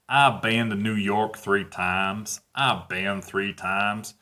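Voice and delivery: put-on slow, drawn-out Southern American accent from Alabama. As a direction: drawl it slowly like someone from Alabama. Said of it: "from Alabama".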